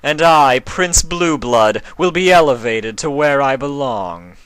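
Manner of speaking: loud, high, haughty villain voice; scheming prince. A narrator's reading. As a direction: gloat over the plan like a vain prince.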